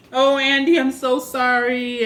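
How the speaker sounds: high, loud, pleading sorrow at the TV; mock tearful apology. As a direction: beg forgiveness like a heartbroken kid, hammed up. [name] hugging the TV, apologizing.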